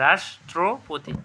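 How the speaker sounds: "রাষ্ট্রপতি" slowly as রাষ্-ট্রো-পোতি; syllable-by-syllable pronunciation. Shown as a demonstration. Teacher quizzing students, "রাষ্ট্রপতি" slow and split. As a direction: break "রাষ্ট্রপতি" into clear slow pieces. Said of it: "কী হবে".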